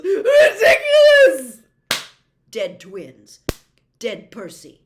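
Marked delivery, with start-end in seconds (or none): 0.0-1.6 high, loud, slow sobbing cry; sobbing shriek. A narrator's reading. Missus Weasley fails the spell, shrieking and sobbing. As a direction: wail the spell through tears, losing control. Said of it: "Missus Weasley moaned".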